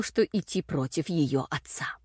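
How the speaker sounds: plain reading voice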